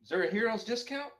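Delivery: high-pitched, audible grin; grinning adventurer. Acted. Adventurer haggles with the innkeeper, grinning and high-pitched. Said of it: "grins and says".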